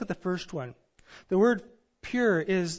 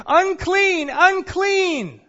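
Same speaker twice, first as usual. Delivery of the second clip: high, loud cry of unclean; illustrative leper's shout; 12 semitones higher, 11 dB louder, much slower than usual. Retold or imitated. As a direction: cry it out like a leper warning people off. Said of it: "they would have to shout".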